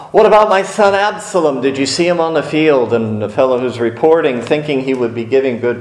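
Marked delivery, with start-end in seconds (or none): 0.1-2.9 anxious question. Acted. Anxious king asks for son.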